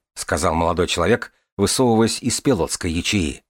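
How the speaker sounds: plain reading voice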